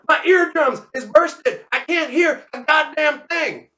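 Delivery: high, deafened holler; hollered complaint. Acted. Deafened Leonard to the mourners, high hollered complaint. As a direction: holler it in the receiving line. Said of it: "he hollered".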